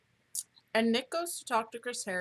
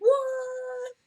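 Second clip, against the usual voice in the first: surprised; 8 dB louder than usual. Real, not acted. Surprised reaction, high pitch.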